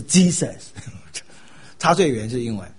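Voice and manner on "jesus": Jesus with a hard J, "JEE-sus"; English J sound. Shown as a demonstration. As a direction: stress the wrong-example Jesus, "JEE-sus". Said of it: "但绝对不是Jesus".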